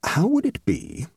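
pensive, lingering proposal; slow and reflective. A narrator's reading. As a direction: float the suggestion slowly and thoughtfully. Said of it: "she added slowly and reflectively".

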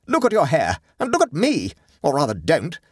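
high-pitched, indignant scorn; scornful snort. A narrator's reading. Guest with undone hair scoffing at dinner, scornful. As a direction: scoff at the very idea. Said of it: "snorted Catherine".

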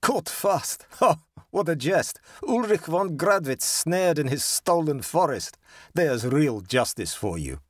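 high, gloating jeer with a laugh; savage mockery. A narrator's reading. Georg jeers at Ulrich snared in stolen forest, savagely mocking. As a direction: crow mockingly over a trapped rival. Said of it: "mockingly and savagely".

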